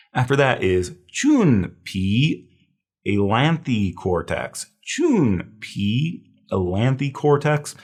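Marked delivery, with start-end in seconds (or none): 1.1-2.4 CHUN with a long oo vowel, drawn out; careful pronunciation. Shown as a demonstration. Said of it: "careful that we're pronouncing this". 4.8-6.2 CHUN with an oo vowel, pitched high; careful pronunciation. Shown as a demonstration. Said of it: "careful that we're pronouncing this".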